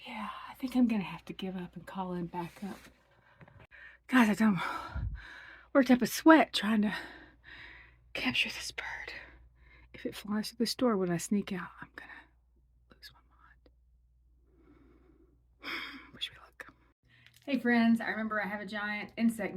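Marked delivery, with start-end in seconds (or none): 0.1-2.8 defeated whisper, giving up. Real, not acted. Whispering, bird nearby. 8.1-16.7 tense whisper while sneaking out. Real, not acted. Sneaking out past the bird.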